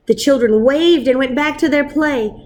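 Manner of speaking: ordinary delivery, nothing marked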